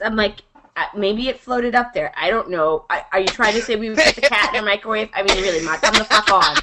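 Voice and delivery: downplay it, cover for your sister; playing innocent.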